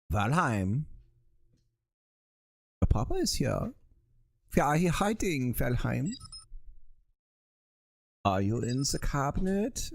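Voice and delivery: hammed-up German papa on the hunt; movie German accent. Papa hunting for hiding Valheim, mock German accent. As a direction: play a searching papa with a stagey German accent. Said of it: "movie version of a, of a German accent".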